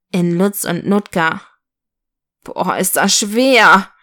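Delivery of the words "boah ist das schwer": slow, mildly whiny groan; drawn-out, lightly acted lament; moaning. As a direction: complain under a heavy load. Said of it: "jammerte Lutz".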